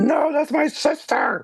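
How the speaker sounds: put-on high, slow, pleading girl's voice; Lisa pleading. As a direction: beg him to spare her sister. S1 voices Lisa protecting sister.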